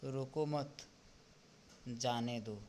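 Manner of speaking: रोको मत joined, long pause, then जाने दो; pause after मत. Shown as a demonstration. Teacher showing how punctuation changes meaning, pause after मत. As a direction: break the sentence after मत, not after रोको. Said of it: "दोनों में अंतर है".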